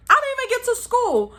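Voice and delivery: high, anguished repeat; exasperated high wail. Acted. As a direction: whine it out like a kid who lost everything.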